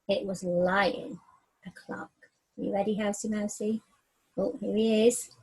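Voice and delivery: slow, weighty storybook reading building toward the roar; story narration. A narrator's reading. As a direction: read it big and grand for the children.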